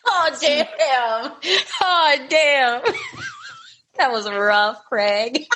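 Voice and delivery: high wheezing laugh through words; wheezy laughing. Real, not acted. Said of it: "I'm wheezing a lot over here today".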